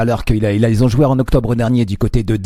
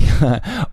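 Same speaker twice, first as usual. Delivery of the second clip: short sheepish laugh; embarrassed laugh; 4 semitones higher than usual. Real, not acted. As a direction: laugh at own stumble. Stumbling over German title.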